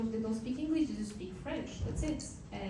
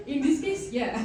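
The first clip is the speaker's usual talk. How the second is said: laughing; 11 semitones higher, 5 dB louder than usual. Real, not acted. Laughing at French fries joke.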